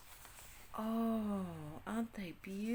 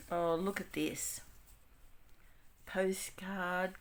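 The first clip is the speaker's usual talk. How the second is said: normal talking voice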